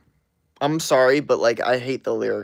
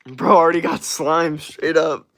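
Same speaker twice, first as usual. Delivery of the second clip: higher and louder, hyped; excited loud reaction; 4 semitones higher, 6 dB louder than usual. Real, not acted. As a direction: call it out with glee. Amused reaction to gift.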